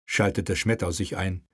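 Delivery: ordinary delivery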